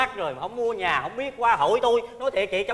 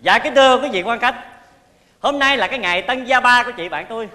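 louder, higher ceremonious address; formal speech; 4 semitones higher, 4 dB louder than usual. Shown as a demonstration. Man gives a mock housewarming speech to the guests, formal. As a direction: open a housewarming toast with pomp. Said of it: "anh biểu diễn giùm tôi đi".

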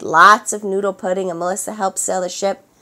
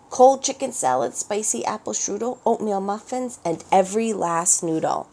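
rhythmic list; 4 semitones higher than usual. A narrator's reading. Long list of stolen food.

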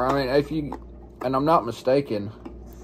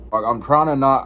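usual speaking voice

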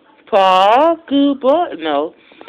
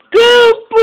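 much higher, loud squeal of praise; excited praise; 11 semitones higher, 8 dB louder than usual. Real, not acted. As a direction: burst out praising the puppy. Praising the dog.

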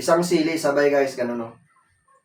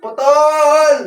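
shout; 9 semitones higher, 7 dB louder than usual. Real, not acted. Game cut off.

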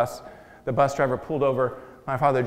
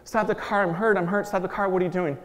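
higher, urgent begging to stop the car; desperate pleading; 6 semitones higher than usual. Retold or imitated. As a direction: plead for your life, voice pitched up. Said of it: "I start pleading with him".

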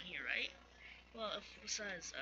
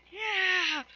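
high, loud battle yell; 11 semitones higher, 9 dB louder than usual. Acted. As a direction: bellow it like a warrior charging in. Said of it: "And she just yells".

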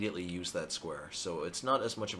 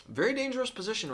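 excited; 13 semitones higher than usual. Real, not acted. Opponent's king under attack.

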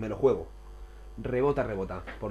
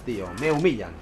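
normal talking voice

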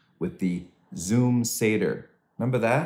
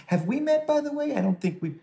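much higher, chirpy small-talk voice. Retold or imitated. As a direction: pop up brightly to a stranger on Zoom. Awkward Zoom small talk.

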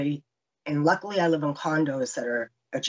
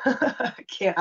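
high laugh breaking into the words; 8 semitones higher than usual. Real, not acted. Survivor marvelling that she was alive, laughing. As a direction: laugh through it in disbelief.

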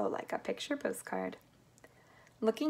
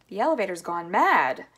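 loud, emphatic outcry; alarmed shout; 8 dB louder than usual. A narrator's reading. Grandpa Joe as the elevator rockets skyward, alarmed shout. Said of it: "shouted Grandpa Joe".

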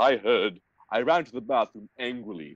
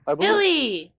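much higher and louder, in a put-on voice; mock grand voice; 4 dB louder than usual. Shown as a demonstration. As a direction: announce it like a pompous herald. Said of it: "I believe that is mine".

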